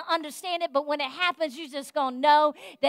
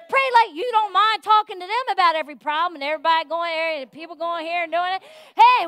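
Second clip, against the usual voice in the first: normal talking voice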